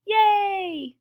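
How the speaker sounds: high, loud crowd yay; cheering. A narrator's reading. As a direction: let out a long happy cheer. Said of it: "the crowd cheered".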